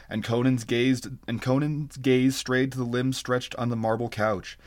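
plain reading voice

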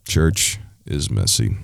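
sighed-down low repeat of Church is messy; low, slow echo. Real, not acted. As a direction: drop it down and drag it out. Repeated refrain, two deliveries.

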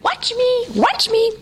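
God teasing, showing off his power; God daring the king. Retold or imitated. God taunting Nebuchadnezzar, high and playful. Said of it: "Yahweh God's going".

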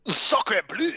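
put-on loud, high hammy French accent. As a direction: exclaim it like an over-the-top Frenchman. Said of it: "did I turn on the French version of it?".

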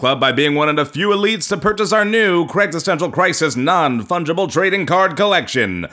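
loud, fast ad pitch; announcer voice. Acted. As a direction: hype it like an infomercial. Mock ad read.

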